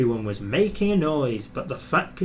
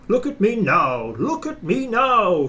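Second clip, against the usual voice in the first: high, louder chugging boast; puffing proudly; 8 semitones higher, 4 dB louder than usual. A narrator's reading. Gordon leaving the station, puffing proudly. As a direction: puff it like a proud engine. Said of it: "he puffed".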